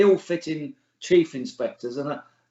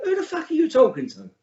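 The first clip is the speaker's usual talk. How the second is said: much higher and louder, outraged challenge; a lad answering a disrespectful stranger, outraged and loud; angry comeback; 11 semitones higher, 4 dB louder than usual. Retold or imitated. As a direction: fire it straight back, offended. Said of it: "you'd say".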